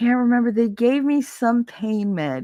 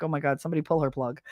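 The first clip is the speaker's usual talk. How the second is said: quick, softer exasperated grumble; exasperated nurses; 3 semitones lower, 7 dB quieter, much faster than usual. Retold or imitated. Nurses grumbling about the patient, quick and soft. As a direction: mutter it fast in exasperation. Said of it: "they were just like".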